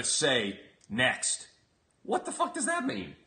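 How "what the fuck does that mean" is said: incredulous. Real, not acted. Incredulous outburst.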